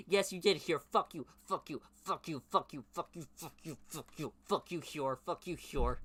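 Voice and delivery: loud, high repeated fuck you; angry cursing. Real, not acted. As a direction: swear at Hyor over and over.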